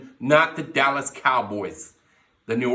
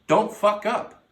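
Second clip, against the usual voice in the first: raised, higher warning straight at him; loud warning; 5 semitones higher, 6 dB louder, slower than usual. Acted. Fan yelling at Trubisky, loud warning. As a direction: yell it at Trubisky like a coach.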